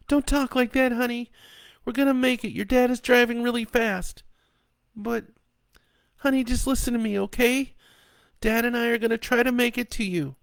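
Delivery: high mother voice, soothing despite fear; reassuring mother. A narrator's reading. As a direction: comfort the child, hide the panic.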